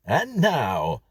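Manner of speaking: high, loud American intro; cheesy American record host; American announcer. Acted. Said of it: "said the voice from the record player".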